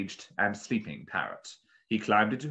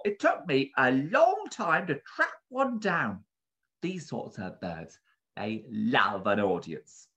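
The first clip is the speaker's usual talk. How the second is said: higher-pitched shopkeeper, pressing the customer; protective bird keeper; 5 semitones higher, 3 dB louder than usual. A narrator's reading. Bird keeper warning a buyer about a rare parrot, earnestly. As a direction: stress how precious the bird is.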